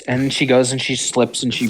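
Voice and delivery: ordinary delivery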